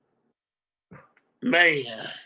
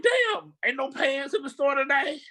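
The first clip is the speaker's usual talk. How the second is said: exasperated; 6 semitones higher than usual. Retold or imitated. Retelling frustration at stores.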